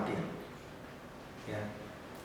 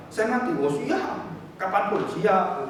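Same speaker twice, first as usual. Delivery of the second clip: confident declaration; 7 semitones higher, slower than usual. Pretend. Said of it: "harus itu orang Kristen".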